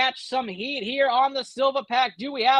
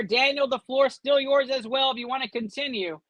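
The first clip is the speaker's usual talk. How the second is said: ordinary talk, nothing marked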